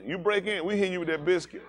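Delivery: ordinary talk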